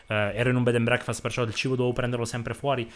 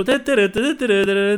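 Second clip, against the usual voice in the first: mock Neapolitan call; 9 semitones higher, 7 dB louder, slower than usual. Mocking Neapolitan street call.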